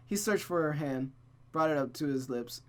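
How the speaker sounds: usual speaking voice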